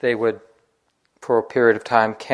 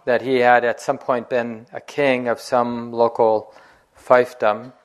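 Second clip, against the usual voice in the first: usual speaking voice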